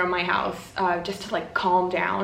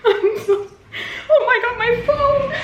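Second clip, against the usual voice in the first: high, loud, laughing outburst; flustered and giddy, voice shooting up; real overwhelmed excitement; 14 semitones higher, 5 dB louder than usual. Real, not acted. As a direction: squeal it through breathless laughter. Overwhelmed reaction to book ending.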